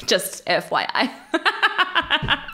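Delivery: laughing slowly through the words; a real laugh spilling into each word. Real, not acted. Laughing aside to listeners.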